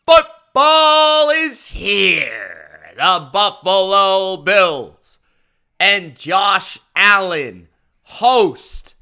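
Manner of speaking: slow, loud, drawn-out hype announcer; big announcer intro. Acted. Streamer opening his live show with a slow, booming announcer intro. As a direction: announce the matchup like prime-time TV.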